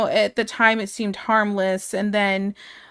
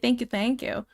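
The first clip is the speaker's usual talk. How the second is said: ordinary delivery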